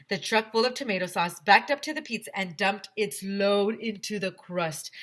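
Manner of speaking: plain reading voice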